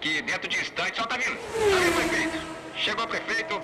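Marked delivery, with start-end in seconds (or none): 1.0-2.4 excited. Acted. Reporter sees the mayor arrive.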